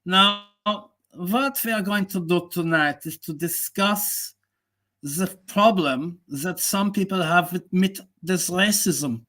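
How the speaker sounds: hammed-up German accent, a pompous host opening a debate. To the stream on tonight's topic, in a slow mock German accent. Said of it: "I'll do the German accent".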